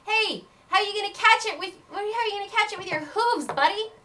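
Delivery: high, loud jeering voice, a bit rushed; loud high mocking crowd taunt. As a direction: heckle him from the stands. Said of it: "and they're saying".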